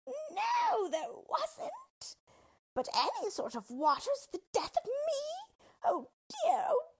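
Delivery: very high, loud despairing wail; dying witch wail. Acted. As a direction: wail while melting away. Witch melting away.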